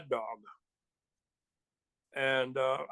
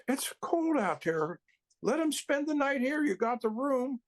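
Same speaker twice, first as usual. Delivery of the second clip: lightly imitated girlfriend, higher pitched; pleading girlfriend; 7 semitones higher than usual. Girlfriend pleading for guest, retold.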